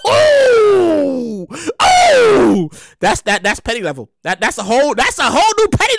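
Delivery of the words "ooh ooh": drawn-out loud "ooh"; sliding, hooting "ooh"; long whoop. Acted. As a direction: whoop with theatrical shock. Whooping over the petty story.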